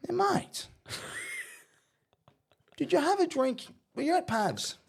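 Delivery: very high-pitched coach impression; high mock-coach questioning; imitating the coach. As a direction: squeak out an interrogation as the coach. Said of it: "and he goes".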